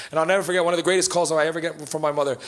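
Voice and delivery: ordinary delivery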